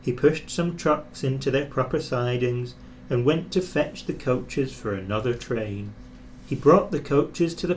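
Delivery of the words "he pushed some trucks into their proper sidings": plain reading voice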